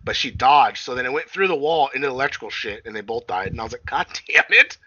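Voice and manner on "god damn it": exasperated. Retold or imitated. Own reaction retold.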